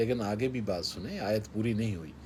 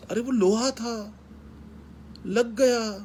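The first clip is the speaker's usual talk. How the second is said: high exclaiming; 11 semitones higher, slower than usual. Real, not acted. Urging forgiveness over car dent.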